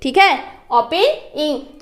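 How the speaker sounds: very slow, drawn-out opening; slow demo. Shown as a demonstration. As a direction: say opening slowly and carefully for the class. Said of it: "ये simple सी बात है". (Opening धीरे-धीरे साफ़ बोलकर)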